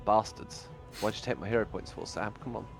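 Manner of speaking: usual speaking voice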